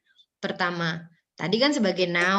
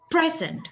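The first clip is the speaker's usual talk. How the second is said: PREsent, stressed on the first syllable; 8 semitones higher than usual. Shown as a demonstration. Teacher demonstrating stress to the class, PREsent on the first syllable. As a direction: hit PRE in present, then let it fall. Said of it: "Penekanannya di pertama".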